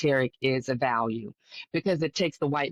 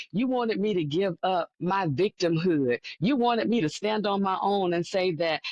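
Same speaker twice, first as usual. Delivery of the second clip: ordinary delivery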